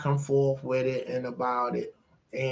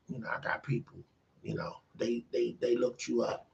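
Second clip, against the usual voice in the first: hushed proposition; 2 semitones lower, 11 dB quieter, faster than usual. Retold or imitated. Billionaire quietly pitching the husband a deal, hushed. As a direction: murmur it like a shady deal.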